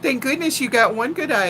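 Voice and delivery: normal talking voice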